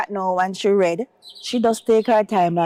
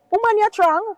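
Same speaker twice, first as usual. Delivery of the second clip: high, louder imitated nurse; nurse's encouragement; 14 semitones higher, 4 dB louder than usual. Nurse embracing the patient, high encouraging imitated voice. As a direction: lift her up like the hugging nurse. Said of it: "she embraced me 'cause she says".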